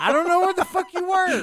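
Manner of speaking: high, loud repeat over interruption; loud exasperated insistence. Real, not acted. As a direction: push it over the other person talking. Repeats himself over interrupting friend.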